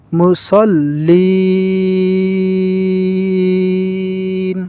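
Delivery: slow chant with the লিন held long; chanted ending; Quran recitation. Shown as a demonstration. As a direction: recite it like a qari. Recites the verse.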